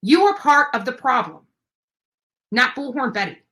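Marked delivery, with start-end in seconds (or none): none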